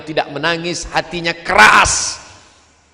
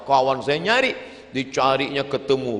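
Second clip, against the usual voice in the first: ordinary talk, nothing marked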